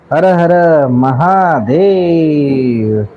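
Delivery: very slow, long-stretched chant; drawn-out rallying cry at talking volume; Shiva war cry. Real, not acted. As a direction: stretch the final word into a long rallying call. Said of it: "तशी शिवगर्जना झाली की".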